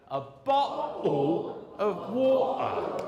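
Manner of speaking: East London accent, "bottle" and "water" with glottal stops, slow, with a laugh; Cockney glottal stops. Shown as a demonstration. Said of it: "everyone together".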